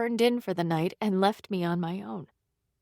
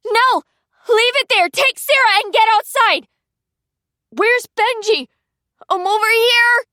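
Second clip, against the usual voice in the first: high, loud, urgent orders; frantic shouting; 12 semitones higher, 7 dB louder than usual. A narrator's reading. Sisters shout amid fire.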